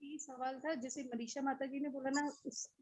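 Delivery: very soft, faint voice; barely audible. Real, not acted. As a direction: speak far too softly to be heard. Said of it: "आपकी आवाज बहुत कम आ रही है".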